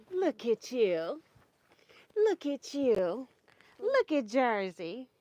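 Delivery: slow cooing to a grandchild; slow sing-song. Real, not acted. Showing toddler the dog.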